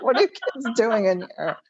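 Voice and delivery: mock scolding through a laugh; giggling imitation of a grown-up scolding; laughing realtor quote. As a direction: laugh while playing the realtor. Kids kicked out by realtors.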